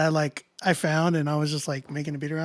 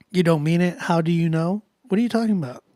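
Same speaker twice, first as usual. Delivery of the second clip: ordinary talk, nothing marked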